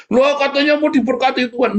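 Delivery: high, loud mock surprise; mock indignant protest. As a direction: whine like a let-down believer. Mimics a protesting believer.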